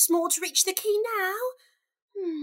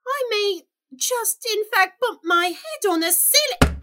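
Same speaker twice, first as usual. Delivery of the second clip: louder, alarmed; rising alarm; 7 semitones higher, 5 dB louder than usual. Acted. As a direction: voice swelling as she grows. Growing huge in the hall.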